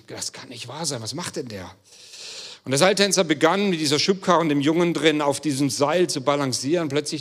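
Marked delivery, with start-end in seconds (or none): 0.1-1.7 soft, worried muttering; uneasy murmur. Retold or imitated. Uneasy crowd below the rope, softly murmuring in disbelief. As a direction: murmur it under your breath in disbelief.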